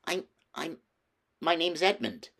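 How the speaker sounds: put-on a boy flustered before the queen; awkwardly. A narrator's reading. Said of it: "said Edmund rather awkwardly".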